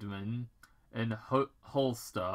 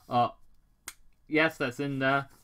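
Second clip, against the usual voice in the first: ordinary talk, nothing marked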